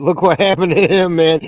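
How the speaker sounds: ordinary talk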